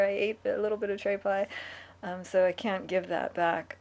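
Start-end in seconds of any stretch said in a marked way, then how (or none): 0.0-1.5 mock confession. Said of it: "I can't say".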